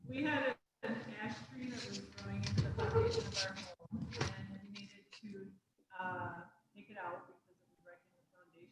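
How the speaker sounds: distant, faint off-mic voice; far from the mic. Real, not acted. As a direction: speak well away from the microphone. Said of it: "we're gonna have to do something about their audio".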